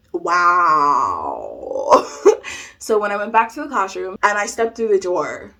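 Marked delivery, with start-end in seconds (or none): 0.1-2.3 low, long drawn-out wow; stunned wow. Retold or imitated. Realizing the teacher really said that, stunned low drawl. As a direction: let it sink in slowly, deep and stretched.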